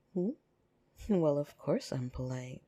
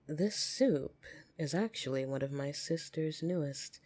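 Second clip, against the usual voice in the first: normal talking voice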